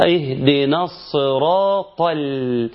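slow الصراط ال, heavy ta then a thin, held l; light lam. Shown as a demonstration. Teacher correcting the slip, lam kept thin. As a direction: keep the l after الصراط light and long. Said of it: "ترقيق اللام".

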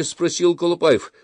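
usual speaking voice

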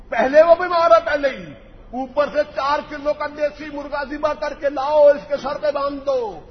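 high, loud scornful outburst; mocking outrage. Real, not acted. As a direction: ridicule the remedy at the top of the voice. Preacher mocks the remedy loudly.

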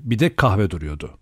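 usual speaking voice

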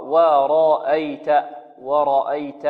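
ورأيت drawn out slowly with a light hamza. Shown as a demonstration. Teacher showing the light hamza. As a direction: stretch ورأيت and keep the hamza thin. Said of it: "الهمزة هنا مرققة".

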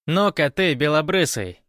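high, loud, commanding woman; bossy woman's voice. A narrator's reading. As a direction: give orders as the woman in charge. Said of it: "распорядился женский голос". (властный женский окрик)

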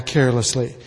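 ordinary talk, nothing marked

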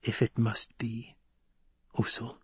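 near-whisper with held-back tears. A narrator's reading. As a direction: accept it tenderly, fading away. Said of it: "Chani whispered".

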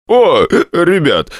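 put-on high, slow drunken greeting; slurring it out happily drunk. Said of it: "заявил он пьяным голосом".

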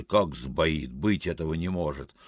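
low, hushed disbelieving voice; low disbelief. Acted. Dark figure at the cash desk, low quiet disbelief. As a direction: say it low and soft to yourself.

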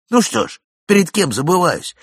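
loud, high, furious roar; thundering. A narrator's reading. Young man roaring at the old gentleman in fury. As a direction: thunder it at him, fists clenched. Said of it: "загремел он".

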